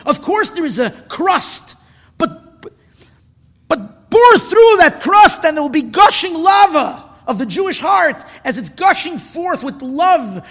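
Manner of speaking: loud, exhorting preacher; impassioned preaching. Real, not acted. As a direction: preach with swelling force, stretching the big words. Preaches at full force.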